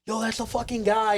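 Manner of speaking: high-pitched fan exclamation; excited recognition. Retold or imitated. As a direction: imitate a fan spotting someone famous. Imitates crowd recognizing him.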